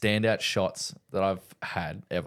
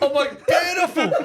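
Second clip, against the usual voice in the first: high, loud, laughing delight; excited; 6 dB louder than usual. Retold or imitated. Trainee thanking the shoe rep, excited. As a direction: crow it at the top of your range. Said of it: "I was really excited and happy".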